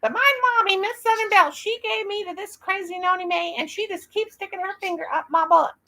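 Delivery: high little doll voice tattling; high childlike doll voice, a bit cheeky. Pretend. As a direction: squeak it up like the doll telling on someone. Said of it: "I don't know what they said".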